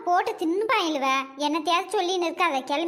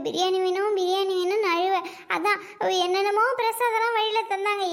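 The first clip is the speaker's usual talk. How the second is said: ordinary talk, nothing marked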